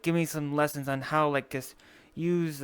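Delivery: usual speaking voice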